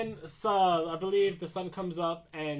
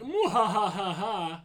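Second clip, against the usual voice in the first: slow, spelt-out villain cackle; exaggerated mwahaha; mock evil laugh; 3 semitones higher, 5 dB louder than usual. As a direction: gloat with a hammed-up evil laugh. Voicing the villain's gloating laugh.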